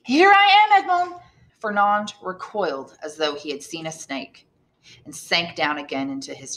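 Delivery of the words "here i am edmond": high, louder joyful call. Acted. Mercedes calling to Edmond at the door, joyful. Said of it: "and called out".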